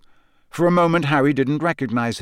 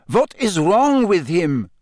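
slow, higher foreign student voice; Krum; 6 semitones higher, 4 dB louder, slower than usual. A narrator's reading. Krum looking down at Crouch in alarm, slow and higher.

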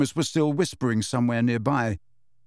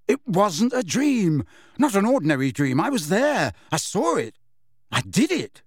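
higher and urgent, pleading to be believed; urgent insistence; 7 semitones higher than usual. A narrator's reading. Harry insisting to Ron it wasn't a dream, urgent. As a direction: press hard so Ron believes.

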